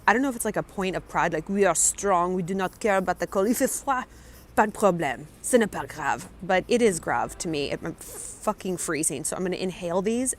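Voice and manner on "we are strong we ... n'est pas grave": imitating a proud French local; proud French accent, played for fun; mock French accent. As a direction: put on a French accent, shrugging off hardship. Imitates proud French people, slipping into French.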